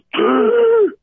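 sharp high gasp for air; a man pulled from the river gasps for breath; imitated gasp. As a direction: act out a drowned man's first gasp. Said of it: "the guy unconsciously goes".

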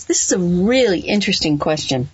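ordinary talk, nothing marked

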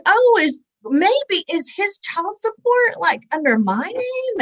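high, slow tentative question; acted-out naive puzzlement. Young woman questioning the sheriff about her name, retold puzzlement.

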